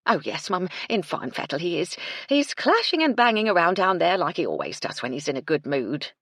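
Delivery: breathless, eager housekeeper, quickening at the end; a bustling cook hurrying up to her employer; Mrs Chubb voice. Acted.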